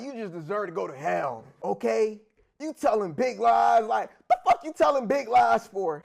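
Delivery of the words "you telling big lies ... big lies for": loud, indignant rant. Real, not acted. Comic ranting loudly at big liars. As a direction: shout the question at the liar.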